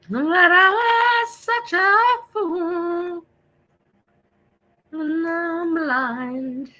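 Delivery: high, very slow mournful wail; ghostly lament. Pretend. Streamer voicing a sad Halloween ghost. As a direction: mourn it like a lost spirit. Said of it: "now I'm being silly".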